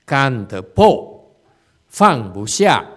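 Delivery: loud, drawn-out Chinese slogan; imitating Chinese Dharma talk. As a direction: punch out the phrase like a Dharma teacher. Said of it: "how the Asians speak when they speak Dharma".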